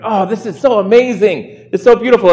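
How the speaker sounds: high-pitched, starry-eyed gushing; gushing admiration. Acted. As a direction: gush like an awestruck fan. Imagined awed fan at the stadium.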